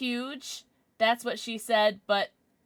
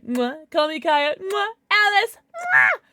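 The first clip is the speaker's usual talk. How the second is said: excited kisses; 7 semitones higher, 8 dB louder than usual. Real, not acted. Streamer blowing kisses to chat.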